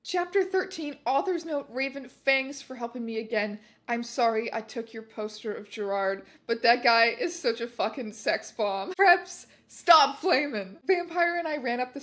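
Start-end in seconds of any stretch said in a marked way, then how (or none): none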